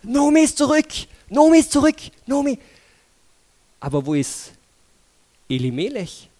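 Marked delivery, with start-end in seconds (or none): 0.0-2.6 high, loud, repeated call that builds; excited calling. Acted. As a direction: shout the news to the whole town. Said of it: "riefen die Frauen". (laut, hoch und aufgeregt rufen)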